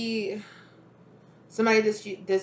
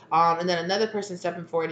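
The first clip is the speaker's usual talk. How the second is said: usual speaking voice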